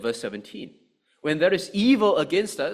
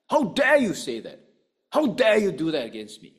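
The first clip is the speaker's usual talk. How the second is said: mock indignant outburst; 5 dB louder than usual. Preacher voicing a proud believer's mock indignation. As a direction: play the offended one, hammed-up outrage. Voicing an offended, reacting person.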